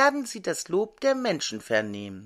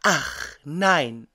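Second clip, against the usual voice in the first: usual speaking voice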